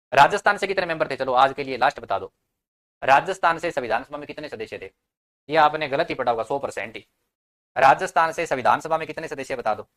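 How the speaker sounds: loud, emphatic quiz question; calling out. Real, not acted. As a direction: fire the question out to the whole class. Calls out quiz question to class.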